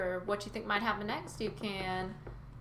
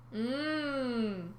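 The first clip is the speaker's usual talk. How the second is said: long hummed moo, rising and falling; cow-like lowing; 4 semitones higher than usual. A narrator's reading. Sea cow reacts to the prophecy, hummed lowing. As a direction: moo with closed mouth.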